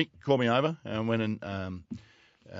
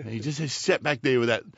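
usual speaking voice

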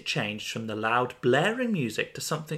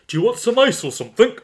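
character line; 10 semitones higher, 8 dB louder, faster than usual. Acted. Acted story dialogue, character offers ice.

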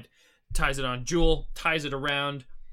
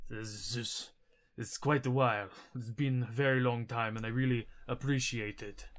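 Belfast; 2 semitones lower, 4 dB quieter than usual. Acted. Belfast thanks fellow giant in their language, soft natural giant voice. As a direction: speak gently, as in his native Giant. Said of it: "this is a natural giant voice".

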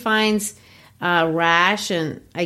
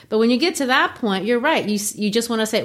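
ordinary talk, nothing marked

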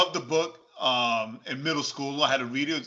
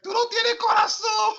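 very high, loud comic wail; mock tearful reproach; 5 dB louder than usual. Coaching friend to accuse dry-eyed girlfriend, mock-sobbing reproach. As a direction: wail it like a hammed-up heartbroken crybaby.

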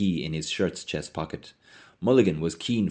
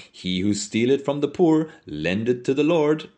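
mock preacher; 7 semitones higher, 4 dB louder than usual. Mulligan grinning, mock sermon.

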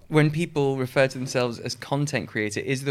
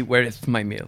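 lisping dog voice; 4 semitones lower than usual. Acted. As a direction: lower dog voice with a lisp. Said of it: "But it would be".

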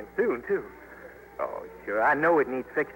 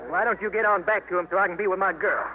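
ordinary talk

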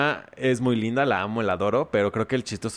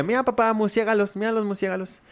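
put-on high excited little-kid voice; a small boy calling out to his dad, high; excited child; 9 semitones higher than usual. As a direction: son pointing out bats to his dad, high and eager.

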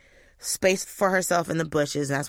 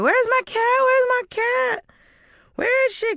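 hammed-up high-pitched alarm; mock panic; 4 dB louder than usual. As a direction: imitate yourself frantically demanding your missing pet. Retelling her panicked text to mother.

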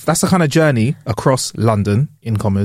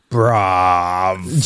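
low, long-stretched bruv; slow, deep catchphrase delivery; drawn-out catchphrase; 6 semitones lower than usual. Shown as a demonstration. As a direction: stretch the word right out, pitched low. Trying out the catchphrase for merch.

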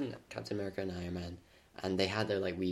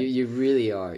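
louder, playfully exasperated "really"; mock exasperated; 4 semitones higher, 11 dB louder than usual. Real, not acted. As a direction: protest in exaggerated annoyance. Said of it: "it's just infuriating".

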